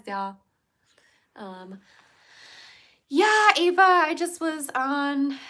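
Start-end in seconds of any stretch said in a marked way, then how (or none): 3.1-5.4 high and loud; excited. Real, not acted. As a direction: burst out excitedly.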